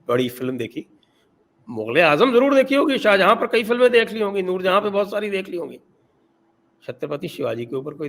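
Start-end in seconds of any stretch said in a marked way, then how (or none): 1.7-5.8 loud, high, mocking jab; sarcastic. Real, not acted. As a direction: sneer it, fast and pointed. Sarcastic jab at Mughal films.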